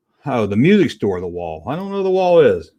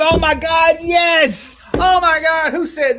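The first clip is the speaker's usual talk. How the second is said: excited shout; 13 semitones higher, slower than usual. Real, not acted. Excited at chat comment.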